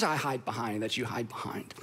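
hushed, secretive aside; whisper. Real, not acted. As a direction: whisper it like a confession. Confiding the hidden excuse.

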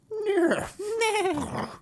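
very high whinnying horse voice; neigh, then plead as a horse; talking horse. Acted. Horse begging the maid to remove its bridle, whinnying. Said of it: "the horse says".

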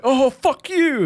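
very high, loud mock yell; hammed-up fury; shrieked mock curse; mock outburst. Voicing mate shooting.

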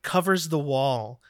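usual speaking voice

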